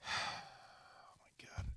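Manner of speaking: whispered, very soft exasperation; real weary whisper of disbelief; exasperated sigh. Real, not acted. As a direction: sigh it out under your breath. Exasperated sigh during the argument.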